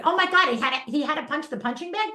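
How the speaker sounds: gasp, then flip it into a cheer; mock outrage turned to cheering. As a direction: parent reacting to the punching bag, loud and high. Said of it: "We look at it negatively".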